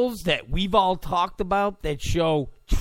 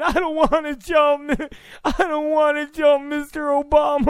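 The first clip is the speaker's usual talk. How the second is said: scared student; 9 semitones higher than usual. Retold or imitated.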